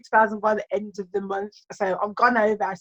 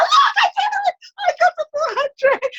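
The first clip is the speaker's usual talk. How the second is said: high, loud, laughing cheer; excited; 3 dB louder than usual. Retold or imitated. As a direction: shout it out like you just won. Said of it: "I was so excited".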